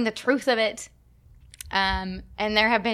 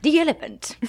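The elephant as tiɛ ləpənt, higher, laughing through a bad French accent; mock French accent; 6 semitones higher, 3 dB louder than usual. Shown as a demonstration. Breaking up mid French accent for the cohost. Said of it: "I can't do a French accent".

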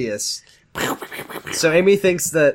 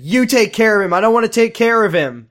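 louder, higher mock yell; 7 semitones higher, 5 dB louder than usual. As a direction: yell it off like a brat. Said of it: "and said".

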